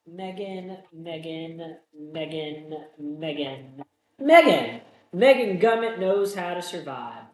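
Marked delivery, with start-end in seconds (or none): 0.1-3.8 soft sing-song repeats of the name; soft teasing call. Pretend. Host softly calling out the final girl's name, teasing. 4.2-6.3 high, loud call of Megan; shouted name. Pretend. Host loudly calling out the final girl's name.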